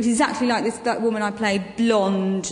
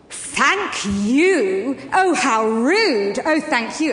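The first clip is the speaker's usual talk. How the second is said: louder, slow mocking sneer; sarcastic posh lady; 4 dB louder, much slower than usual. Posh shopper sneering at the pregnant narrator, mock indignant. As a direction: imitate a snooty woman scolding. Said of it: "she went".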